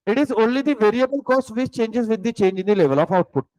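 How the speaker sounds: very slow note-taking dictation; teacher dictating a definition slowly. Acted. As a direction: spell it out word by word for students to write. Dictates notes to class.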